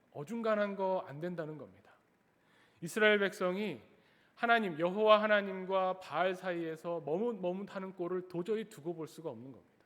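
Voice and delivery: ordinary delivery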